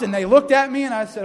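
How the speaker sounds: ordinary talk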